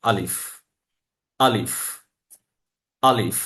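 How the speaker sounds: আলিফ said three times, easy and relaxed, falling; a gentle smile in the voice on আলিফ; alif with a slight smile. Shown as a demonstration. Quran teacher shows alif: relaxed, smiling repeat. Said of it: "একদম simply আপনি উচ্চারণটা করবেন".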